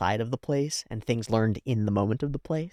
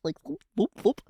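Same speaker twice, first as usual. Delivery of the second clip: sneaky whoops; 11 semitones higher, faster than usual. Retold or imitated. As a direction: tiptoe the sound like a mouse. Imitating sneaking mouse sound.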